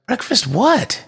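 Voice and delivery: put-on high little-girl voice, rising question; doubtful child. A narrator's reading. Bella questioning her sister about breakfast, high and doubtful. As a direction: go high and lift the last word sceptically.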